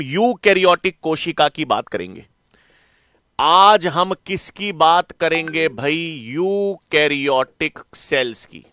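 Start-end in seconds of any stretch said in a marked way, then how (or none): 3.4-8.6 drawn-out words, question then answer; slow teacherly repetition. Real, not acted. Teacher announces today's topic to the class, slow repetition. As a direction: spell out the topic slowly for the class.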